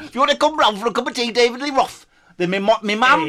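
high, loud silly character; Brett Tuggle. Pretend. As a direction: introduce yourself in a daft put-on voice. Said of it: "my name's Brett Tuggle".

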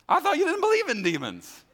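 high, loud, laughing jab; mocking retort. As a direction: tease them with a laugh.

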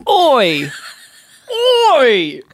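mock Aussie call, drawn out; Australian accent. Host echoing co-host's intro, mock Australian call.

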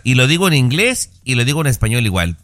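ordinary delivery

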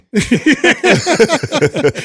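high burst of real laughter. Real, not acted. Dad laughing about bribing son with ice cream, real laugh. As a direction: laugh at your own trick.

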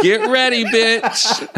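laughing as she warns her friend; teasing high warning. Pretend.

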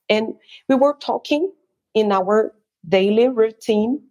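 usual speaking voice

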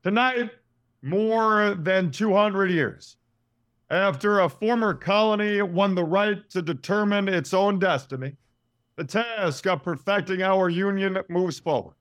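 grand presidential address with long pauses; Obama speech voice. Acted. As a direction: deliver it like a victory speech. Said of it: "Obama when he is giving a speech".

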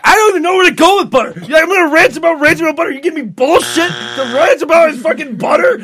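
fake-furious tirade that can't stay mad; mock rant. Co-host asked to close the show with a hammed-up butter rant. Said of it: "just continue ranting about butter, please".

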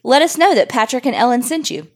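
ordinary talk, nothing marked

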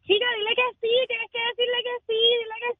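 high-pitched mock yell, fading in volume; imitating urgent yelling. Bystander urging a woman to accept a proposal, imitated high yelling. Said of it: "empezó a gritarle".

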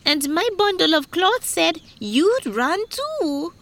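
high, urgent complaint; worked-up weaver's testimony; agitated weaver. Acted. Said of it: "began to recite their troubles".

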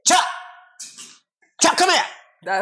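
high, sharp imitation of yelling at a dog; exaggerated mock yelling. Husband exaggerating how wife called Chuck, high mock yell. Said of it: "Why the fuck are you yelling at him like that?".

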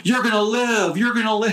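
high put-on man's voice; imitating the kidnapper. To the kidnapped woman, a high mock reassurance. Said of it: "He's like".

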